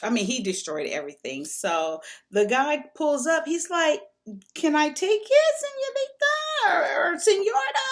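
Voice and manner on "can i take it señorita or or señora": imitating a Mexican man's broken English, much higher voice; broken English Mexican accent. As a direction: put on the Mexican guy's accent, high and eager. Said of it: "he's talking to me in his broken English".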